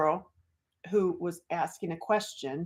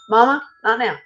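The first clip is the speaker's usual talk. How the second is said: shooing off mom; 4 semitones higher, 6 dB louder than usual. Real, not acted. Shooing her mom away.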